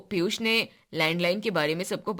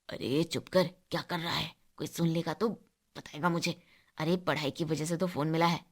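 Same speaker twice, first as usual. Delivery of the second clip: hushed whisper; 2 semitones lower, 5 dB quieter than usual. Acted. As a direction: shush him, keep it secret and soft. Hushing friend so nobody hears.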